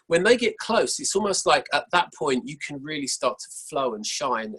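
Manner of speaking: ordinary talk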